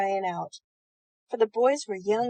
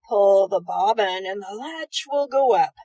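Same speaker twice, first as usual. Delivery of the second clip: faking Granny's voice; 2 semitones lower, 7 dB louder than usual. Acted. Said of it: "trying to sound like Granny".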